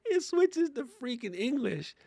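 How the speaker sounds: usual speaking voice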